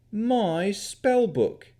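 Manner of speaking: put-on slow, high, clutching the book defensively in a country yokel accent; guard the book with the words. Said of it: "he said defensively".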